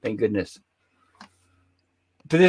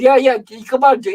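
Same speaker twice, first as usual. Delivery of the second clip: high, loud, impatient urging; exasperated director; 11 semitones higher, 7 dB louder than usual. Retold or imitated. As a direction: push him to just start already.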